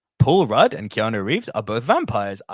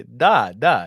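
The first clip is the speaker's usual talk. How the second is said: mock Russian da; 7 dB louder than usual. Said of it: "see if she speaks Russian".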